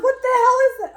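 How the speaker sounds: spooked by something glowing; alarmed. Retold or imitated. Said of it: "I was like".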